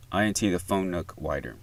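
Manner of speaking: usual speaking voice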